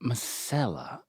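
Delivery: masala with an open ah in the middle; corrected masala. Shown as a demonstration. Daughter quietly models how to say masala. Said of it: "That's not right".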